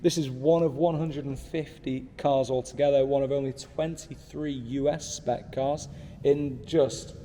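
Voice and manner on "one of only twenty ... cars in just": ordinary delivery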